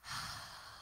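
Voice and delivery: slow audible exhale; soft sighing out-breath, the name of God; long breath out. Shown as a demonstration. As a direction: breathe out slowly so it can be heard. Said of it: "there is his name".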